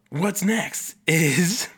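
"what's next" stretched very slowly, rising, with a laugh; "what's next" said very slowly and rising to tease the next topic; drawn-out what's next. Shown as a demonstration. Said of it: "change my inflections or my tone of voice".

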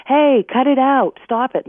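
loud, slow, scolding yell at a barking dog; retold yell. Trainer imitating an owner yelling at the dog, loud and slow. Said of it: "we yell".

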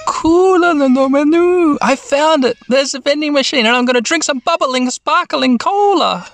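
high, sing-song excitement; delighted girl. Acted. Mimi finds the cola vending machine, delighted. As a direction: squeal it high, stretching the last words.

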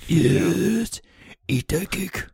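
a hint of a lurking creep urging someone on; creepy costumed mascot. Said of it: "creeping around a corner in a Grimace costume".

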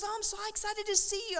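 imitating a bubbly woman, very high-pitched. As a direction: gush in a high, excited mimicked voice. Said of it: "all bubbly".

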